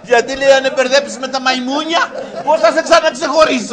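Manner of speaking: laughing through the words, high and loud; can barely get it out for laughing. Real, not acted. Laughing through joke punchline. (γελώντας δυνατά)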